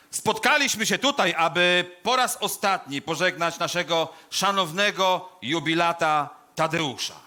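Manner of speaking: pompous eulogist, projecting every phrase; solemn funeral oration. Acted. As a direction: proclaim it grandly to the assembled mourners. Delivers a funeral speech.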